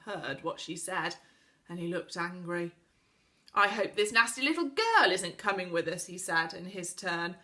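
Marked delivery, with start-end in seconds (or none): 3.5-6.1 resentful retort. A narrator's reading. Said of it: "glared at Connie".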